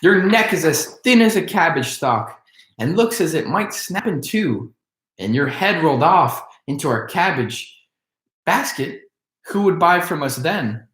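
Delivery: put-on high, anxious boy's plea; frightened boy. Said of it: "beginning to feel frightened".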